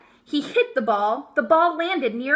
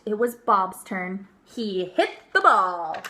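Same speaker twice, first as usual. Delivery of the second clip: plain reading voice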